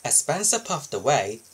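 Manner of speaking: ordinary delivery, nothing marked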